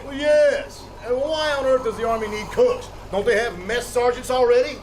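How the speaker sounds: high, huffy outrage; scandalised snob complaining; pompous indignation. Acted. Pompous man indignant about cooks.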